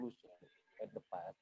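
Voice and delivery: very soft, barely audible; not loud enough. Real, not acted. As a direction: say it far too softly to carry. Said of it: "You're not that really loud".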